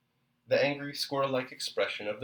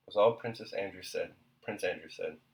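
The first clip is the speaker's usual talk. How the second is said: ordinary delivery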